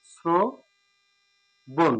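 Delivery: শ্রবণ stretched very slowly, with a dental s sound; slow model pronunciation. Shown as a demonstration. Teacher demonstrating শ্রবণ with a dental s. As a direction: draw শ্রবণ out slowly, স্রো-বোন. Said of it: "আবার বলছি".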